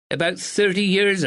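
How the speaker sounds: a proper village neighbour, a touch anxious; spinster neighbour. A narrator's reading. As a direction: speak as a dutiful northern spinster. Miss Bennet voiced.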